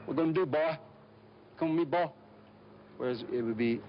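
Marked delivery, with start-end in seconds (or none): 0.0-2.1 chopped, clipped hill-country Southern accent, pitched higher. Shown as a demonstration. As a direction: cut every word short, backwoods Southern. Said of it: "very chopped, very clipped".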